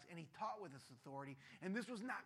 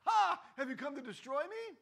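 louder, higher mocking "Ha"; mock demon opener; 7 semitones higher, 11 dB louder than usual. Preacher mocking the demon's opening line, louder. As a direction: ham up the demon's greeting. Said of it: "by going".

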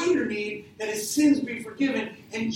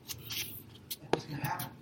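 1 suspense whisper; 6 semitones lower, 11 dB quieter, slower than usual. Real, not acted. Suspense before the miracle.

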